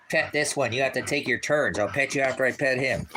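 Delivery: usual speaking voice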